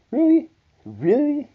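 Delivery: a stunned, mocking "really?"; incredulous. Disbelief at her cheating.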